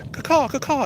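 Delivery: high, loud mock caw; playful imitation crow cawing; crow call. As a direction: squawk like a crow. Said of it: "King Crow says".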